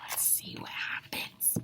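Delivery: hushed suspenseful whisper. Real, not acted. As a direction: lean in and whisper. Teacher whispers to children.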